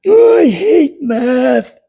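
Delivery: put-on high, slow sulky child voice; very high drawn-out childish complaint; grumpy kid Tom. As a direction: whine it like a kid who hates homework.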